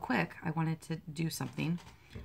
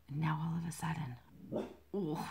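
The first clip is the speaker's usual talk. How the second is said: soft whispered complaint; annoyed whisper; 7 dB quieter than usual. Real, not acted. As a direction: breathe it out, fed up. Dog barking during recording.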